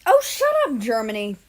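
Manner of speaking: high, loud shout of shut up; annoyed yell. Acted. Squabbling friends yell at Germany.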